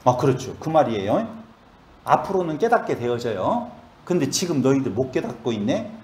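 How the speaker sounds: ordinary delivery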